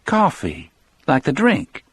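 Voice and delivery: high, bright-eyed woman's question; high sick woman's light wonder; amused question. A narrator's reading. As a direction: lift it up with gentle amusement. Melly asks, amused.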